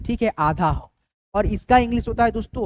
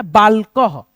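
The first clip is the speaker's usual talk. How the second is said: बालकह said louder, final ह clearly breathed; emphasized word; 7 dB louder, much slower than usual. Shown as a demonstration. Teacher loudly presenting the word for students to write. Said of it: "क्या लिखना है".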